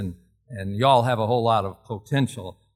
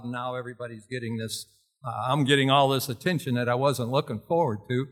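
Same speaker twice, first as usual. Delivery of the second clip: ordinary delivery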